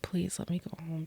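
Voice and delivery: soft unvoiced pleading; repeating her plea to the nurse in a whisper; begging whisper. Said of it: "I was like".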